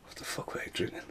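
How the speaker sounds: whispered in disbelief; bewildered whisper. Retold or imitated. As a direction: breathe it out quietly, baffled. Bewildered inner thought on film.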